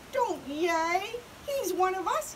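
high-pitched mild yell. A narrator's reading. Pip scolding the cheering crowd, yelling. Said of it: "yelled Pip".

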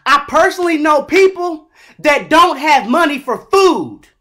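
ordinary delivery

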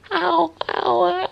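real pained yelps, building; can't take the pain any longer; crying out in pain. Real, not acted. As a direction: voice jumping high and loud in pain.

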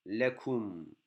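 ordinary delivery

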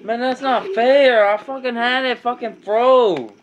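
loud, high furious complaint; real gamer rage over the controller; angry rant. Real, not acted. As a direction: vent it hard at the screen. Player ranting after the loss.

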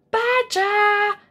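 high, loud call of "Badger!"; a bear crying out to Badger. A narrator's reading. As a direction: call his name out sharply. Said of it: "cried out loud".

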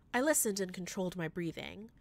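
ordinary delivery, nothing marked

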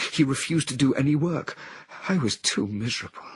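a woman in a trance, full of anguish. A narrator's reading. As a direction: confess her shame and despair. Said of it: "continued in anguish".

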